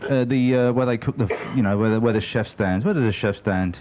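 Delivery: ordinary delivery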